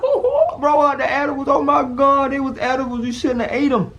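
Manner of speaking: high, loud, laughing imitation of a cackling buddy; mock the friend cracking up at him. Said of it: "he started laughing like".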